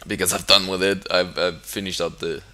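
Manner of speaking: usual speaking voice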